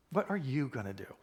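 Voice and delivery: you picked out and stressed mid-question. Shown as a demonstration. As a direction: make you the focus of the question. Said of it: "I put the emphasis on".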